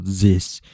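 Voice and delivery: "zis" with a buzzing z instead of th; mock German "zis" for this; "zis" said with z, not th. Shown as a demonstration. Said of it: "little Z's".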